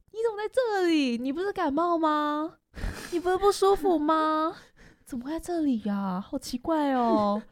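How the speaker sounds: high, bouncy, teasing surprise; mock surprised classmate. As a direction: imitate a cheeky classmate catching you out. Classmate bounces over, asks why.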